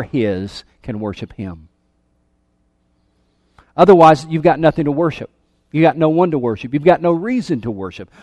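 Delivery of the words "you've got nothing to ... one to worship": ordinary delivery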